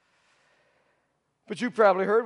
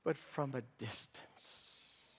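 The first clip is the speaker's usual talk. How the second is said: broken whisper; 5 semitones lower, 15 dB quieter, slower than usual. Acted. Shame at the cross.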